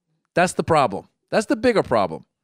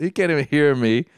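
ordinary talk, nothing marked

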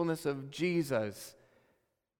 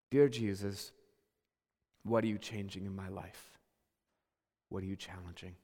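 softer, lower, hushed prayer voice; humble prayer; 11 semitones lower, 6 dB quieter than usual. Retold or imitated. Pastor voicing a believer's surrender to Jesus, hushed prayer. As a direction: pray it quietly, almost to yourself.

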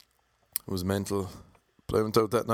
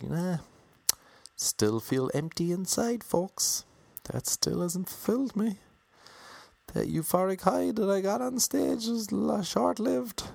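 high, sustained put-on voice; inner voice; 12 semitones higher than usual. Comic voicing his emptiness after the show, high-pitched. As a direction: hold it way up high, as a mock inner lament.

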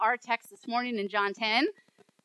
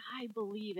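soft, low, moved confession; tearful belief, understated; 4 semitones lower, 12 dB quieter than usual. Retold or imitated. Healed blind man confesses to Jesus, gently tearful. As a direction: quietly voice a man overcome, without sobbing. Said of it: "he's crying and he says".

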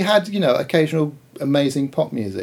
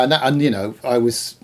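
ordinary talk

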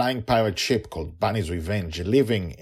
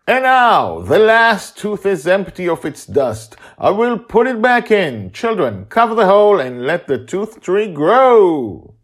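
high, loud, ceremonial proclamation; grand wizard proclaiming; 12 semitones higher, 12 dB louder than usual. Acted. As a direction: announce the ritual like a showman magician. Justin's ceremonial speech.

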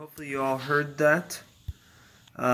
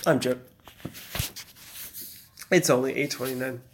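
ordinary talk, nothing marked

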